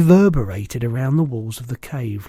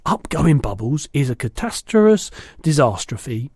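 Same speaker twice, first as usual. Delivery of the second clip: usual speaking voice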